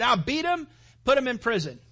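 high, loud careless command; brutal order. Retold or imitated. Magistrates ordering a beating, high and loud. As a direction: bark it out like a callous official.

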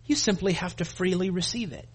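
usual speaking voice

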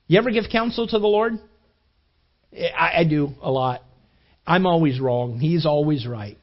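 normal talking voice

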